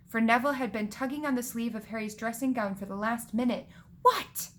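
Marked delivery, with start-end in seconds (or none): 4.0-4.5 high, loud exclamation; irritated "what". A narrator's reading. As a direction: fire it out high at someone pestering you. Acted irritated reply to tugging.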